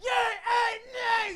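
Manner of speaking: a hint of a gruff scary Irish type, shouted. Comic mimicking the scary kind of Irish, shouted. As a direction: bark it out like a hard, scary local. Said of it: "the scary".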